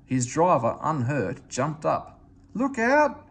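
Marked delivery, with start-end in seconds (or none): 2.5-3.2 loud high "look out"; loud, high alarmed shout; shouted warning. A narrator's reading. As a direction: yell a warning to the engine. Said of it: "he shouted".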